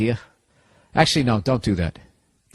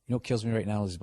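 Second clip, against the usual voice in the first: normal talking voice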